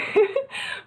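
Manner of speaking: high airy giggle; breathy laugh. Real, not acted. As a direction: let out a light breathless laugh. Laughs after her story.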